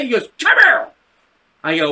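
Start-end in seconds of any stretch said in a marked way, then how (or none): 0.4-0.9 high, loud angry order; imitated yell. Teacher ordering the kid back, yelling. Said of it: "He yelled something".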